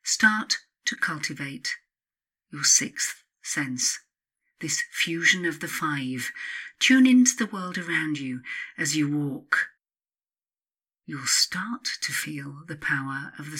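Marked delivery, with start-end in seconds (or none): none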